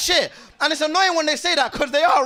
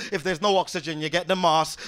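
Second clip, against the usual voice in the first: ordinary talk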